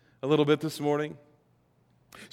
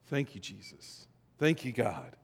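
very soft, low prayer; quietly voiced grateful prayer; humble thanks; 6 semitones lower, 12 dB quieter than usual. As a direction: drop to a hushed, acted thanksgiving.

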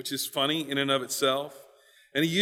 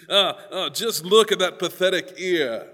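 raised, snooty voice of a talking eye; comic haughty character, pitched up; 4 semitones higher, 5 dB louder than usual. Acted. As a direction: look down on the lesser body part. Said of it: "It's very haughty".

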